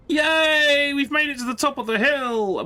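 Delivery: high, loud victory cheer; yelling yay up high and loud. Real, not acted. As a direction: celebrate reaching the top. Cheering reaching hilltop.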